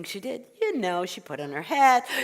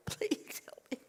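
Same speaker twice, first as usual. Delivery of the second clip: slow, high whisper; desperate plea; 8 semitones higher, 11 dB quieter, slower than usual. Acted. Old woman begs rabbi for advice, desperate whisper. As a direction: beg in a slow breathy hush.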